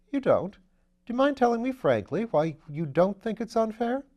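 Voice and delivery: put-on an airy young lord teasing his valet; Lord Peter.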